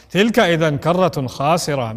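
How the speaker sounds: low, mocking تلك إذا كرة خاسرة with a sneer on تلك; sarcastic. Deniers sneering at the second life, low mocking voice. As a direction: scoff it out as a joke. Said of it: "making this statement sarcastically".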